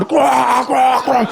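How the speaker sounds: hammed-up brawling grunts; mock fighting grunts. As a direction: grunt like a fighter landing blows. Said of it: "Fallon Fox going".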